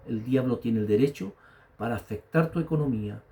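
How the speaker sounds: ordinary delivery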